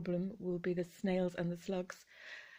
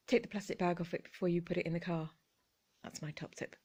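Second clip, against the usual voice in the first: normal talking voice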